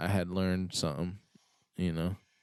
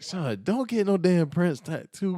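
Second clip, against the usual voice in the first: higher, louder imitation of the dad; dad's warning; 11 semitones higher, 6 dB louder than usual. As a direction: scold him like a stern father. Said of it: "he's like".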